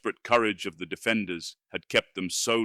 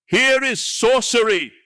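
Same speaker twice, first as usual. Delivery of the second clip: high, loud feline scream of fury; 8 dB louder than usual. Acted. Wizard sees Conan, screams in fury. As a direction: scream it like a cornered cat. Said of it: "screamed Sota".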